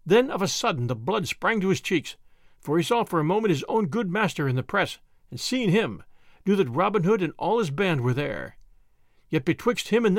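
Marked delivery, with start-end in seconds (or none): none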